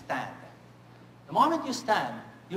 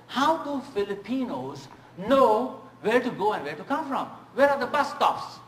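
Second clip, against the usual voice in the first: much higher, louder, put-on voice; imitating a puzzled American; 7 semitones higher, 5 dB louder than usual. American guest quizzes her driver, high and baffled. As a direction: play the baffled tourist asking loudly.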